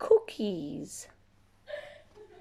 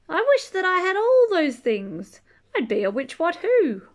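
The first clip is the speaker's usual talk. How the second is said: higher, louder, building to the big wish; excited reveal; 9 semitones higher, 8 dB louder than usual. Acted. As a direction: announce it like a grand idea. Climax of the wish.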